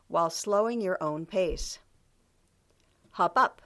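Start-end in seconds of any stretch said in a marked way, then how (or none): 3.1-3.6 peppy upbeat command. Shown as a demonstration. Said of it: "said in a peppy, upbeat voice".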